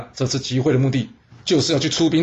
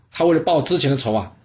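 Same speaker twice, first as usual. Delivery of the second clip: ordinary delivery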